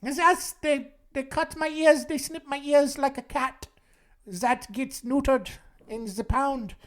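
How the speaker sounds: high put-on German accent, slowing down. Impostor explaining his ears, German accent. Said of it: "this increasingly German accent you're talking with".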